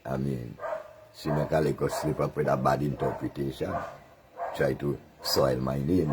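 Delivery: ordinary talk, nothing marked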